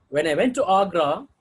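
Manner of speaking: "When I went to Agra" loud, rising on "Agra". Shown as a demonstration. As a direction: leave "Agra" lifted, the sentence unfinished. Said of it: "first, uh, tone group may ask in rising tone".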